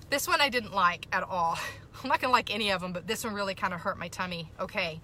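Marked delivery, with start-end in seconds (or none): none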